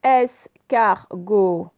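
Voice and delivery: "Escargot" very slow and loud, in three pieces; syllable by syllable. Shown as a demonstration. Teacher showing O at the end, syllable by syllable. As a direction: separate each syllable of "Escargot", holding "got". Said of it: "c'est à la fin".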